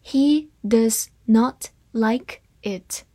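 slow, word-by-word model reading; spaced-out teaching pace; slow read-through. Shown as a demonstration. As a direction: say each word separately and slowly. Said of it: "好，慢读一遍".

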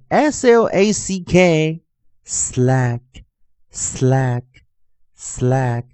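letters spelled out, then "slack" said slowly three times; slow spelling drill. Shown as a demonstration. Teacher leading students through new words, slow drill. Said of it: "同学们跟着老师练习一下".

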